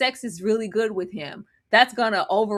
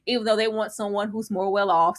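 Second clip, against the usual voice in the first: normal talking voice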